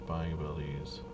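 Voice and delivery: ordinary talk, nothing marked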